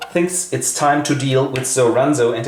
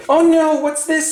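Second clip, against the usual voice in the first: much higher, hammed-up dismay; a pitched-up girlish fret; mock alarmed sister. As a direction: gasp it out way up high. Sister reacts to brother.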